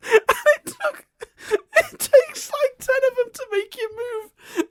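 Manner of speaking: very high, squeaky, tearful-sounding laughing speech; helpless laughter. Real, not acted. Telling co-host about ten earthbenders, in hysterical high squeals. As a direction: barely get the words out for laughing.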